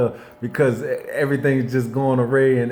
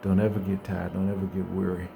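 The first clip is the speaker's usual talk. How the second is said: gentle reassurance; 6 semitones lower, 4 dB quieter than usual. Retold or imitated. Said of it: "He said".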